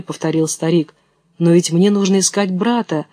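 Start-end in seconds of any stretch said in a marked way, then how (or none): none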